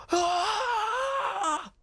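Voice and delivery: mock screaming fan. Streamer mimics the sports guy screaming at a baseball game, shrill shriek. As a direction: wail it like a sports fan losing it. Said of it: "This guy screams bloody murder".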